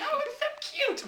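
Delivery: high, breathy-soft mock coo with a laugh; cooing woman. Woman gushing over the worm creature, high mock coo.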